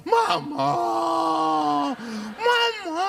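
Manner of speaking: high, drawn-out mock sob; mock children's wailing. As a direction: imitate a child crying out for mother. Imitating children moved to tears. (menirukan anak menangis memanggil mama)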